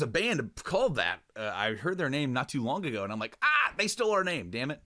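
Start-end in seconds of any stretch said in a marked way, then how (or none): 3.4-4.8 high-pitched cry of protest; mock outrage. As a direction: act out indignation, pitch jumping up. Acts out reaction to stolen name.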